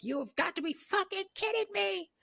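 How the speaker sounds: very high, disbelieving voice; mock losing it in disbelief; incredulous Heather. Heather losing her mind at Mike, very high. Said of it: "Heather loses her mind".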